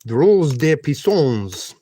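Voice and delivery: slow, hammed-up French accent. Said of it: "getting into the French mode".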